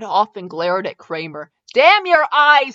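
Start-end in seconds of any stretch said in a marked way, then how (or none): 1.7-2.7 louder and higher, slow angry shout. Acted. Edward shouting at Kramer after the sparks, angry. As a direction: bellow it at the sparks' culprit. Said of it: "he shouted".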